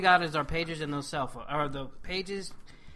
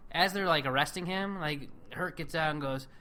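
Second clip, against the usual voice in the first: usual speaking voice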